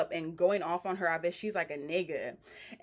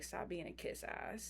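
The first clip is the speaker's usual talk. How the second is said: very soft, slow muttered aside; under her breath; 12 dB quieter, slower than usual. Retold or imitated. Sapphire sniping at a kiss ass, muttered under her breath. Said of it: "under her breath is like".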